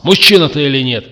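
fast, high, loud challenge; yell. A narrator's reading. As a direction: shout it as a rebuke. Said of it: "заорал Макс". (громко, быстро, с криком)

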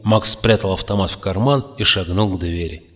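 ordinary delivery, nothing marked

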